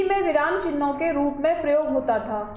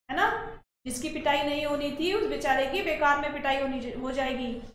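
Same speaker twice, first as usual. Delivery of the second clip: usual speaking voice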